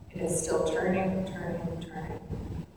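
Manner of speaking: fading to a whisper on each turning; fading repetition. Acted.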